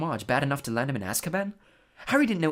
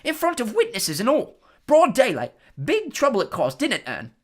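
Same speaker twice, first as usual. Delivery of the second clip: much higher, loud chatty Cockney lad; gossipy bus conductor; 11 semitones higher, 8 dB louder than usual. Acted. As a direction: relish the juicy gossip. Stan gossiping to Harry.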